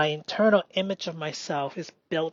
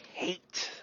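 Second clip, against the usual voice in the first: whisper; 9 dB quieter than usual. Real, not acted. Whispered naming.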